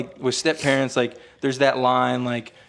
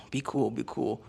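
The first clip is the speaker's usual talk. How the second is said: muttered self-calming; 10 dB quieter than usual. Retold or imitated. Calming himself as stepdad arrives.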